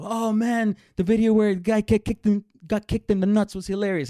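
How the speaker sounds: high, loud blurting classmate; oblivious kid's gleeful outburst; excited yell. Retold or imitated. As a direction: yell it out like a thrilled kid. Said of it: "I hear Trevor just yell".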